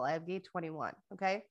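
ordinary talk

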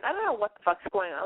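usual speaking voice

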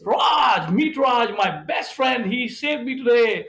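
hearty governor. Retold or imitated. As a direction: proudly show off your new friend to the room. Governor introduces Raj to friends.

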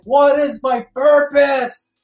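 high, loud, drawn-out plea; re-enacted desperate yell. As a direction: wail it slowly up at the sky. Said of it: "I was crying and yelling at the sky".

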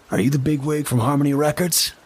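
high, smug, needling voice; smug official. Acted. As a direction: lean in and needle him smugly. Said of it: "A smug, high-ranking official".

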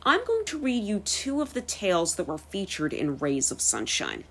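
plain reading voice

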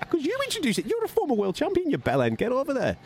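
very high, indignant mock protest; exasperated retort. Urging the former champion over, exasperated. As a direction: send him off in a high-pitched squawk. Acted argument over an introduction.

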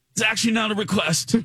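a hint of a curt British set guy; dismissive British accent. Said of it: "he was so dismissive".

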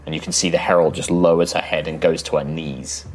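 usual speaking voice

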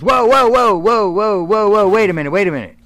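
high, loud whoa, stopping everything; astonished shout. Retold or imitated. Larry stops the wrestlers, astonished.

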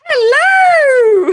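1 mock shout. Mock English greeting shout.

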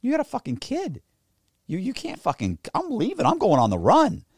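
high-pitched, exasperated protest; retold incredulous argument. Arguing with girlfriend about her son, exasperated. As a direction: argue back in squeaky disbelief. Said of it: "I'm like".

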